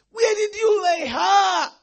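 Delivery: high, loud slow cry; shouted plea; anguished shout. Acted. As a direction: call out desperately. Peter asks, shouting.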